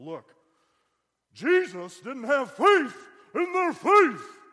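drawn-out, very high full-force bellow; old-school preacher shout. Retold or imitated. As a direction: thunder it slowly in hammed-up old-school style. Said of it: "I can be old school".

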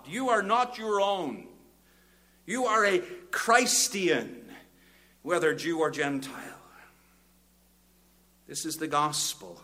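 high, loud, booming pulpit delivery; preaching at full force. Acted. Preacher urging Christians they are not their own, booming. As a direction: build to a ringing climax, hammering each line. Sermon climax on citizenship in heaven.

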